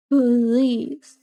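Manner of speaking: usual speaking voice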